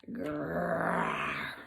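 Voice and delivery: a lion growling at the tailors. Acted. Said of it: "said the lion".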